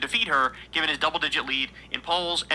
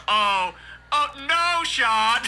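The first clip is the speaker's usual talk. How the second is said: gleeful dismay; 7 semitones higher, much slower than usual. Real, not acted. Host calling out Sean's cover-up with gleeful dismay. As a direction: call him out as if you caught him.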